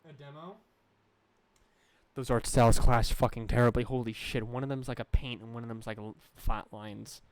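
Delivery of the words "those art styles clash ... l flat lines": loud, exasperated complaint; loud rant. Real, not acted. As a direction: vent it out loud. Ranting about clashing art.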